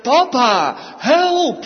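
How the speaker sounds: put-on high, loud plea of a frightened little boy; child's cry for help. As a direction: cry out to daddy like a scared child. Child crying to father.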